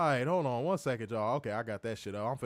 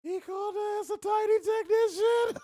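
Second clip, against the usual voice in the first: mock voice; 4 dB louder than usual. Mocking viewer's phrase.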